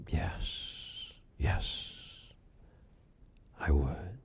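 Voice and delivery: whispered, meek and tearful. A narrator's reading. Mary meekly agreeing to the stranger in a whisper. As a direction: breathe it out humbly. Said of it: "she humbly and meekly said".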